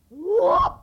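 sudden very loud high whoop; stick swing whoop. Acted. Kanzo swings his big stick at the birds, loud high whoop.

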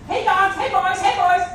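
high, loud, repeated holler; calling the dogs. Acted. Old man calling dogs that won't answer, hollering. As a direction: shout out urgently for missing hounds. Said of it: "gotta get those dogs".

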